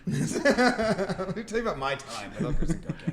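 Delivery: high, drawn-out mock storyteller through laughter; laughing. Teasing a friend about his field trip story, laughing. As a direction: tease with a slow, laughing mock reminiscence.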